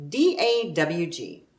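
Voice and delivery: normal talking voice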